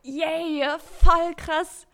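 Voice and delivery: high, loud burst of joy; excited cheer. Acted. [name] cheering to herself about the day, excited. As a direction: cheer it out, thrilled.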